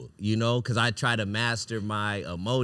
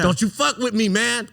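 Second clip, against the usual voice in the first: Matt Damon impression; 13 semitones higher, 7 dB louder than usual. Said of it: "like Matt Damon and Good Will Hunting".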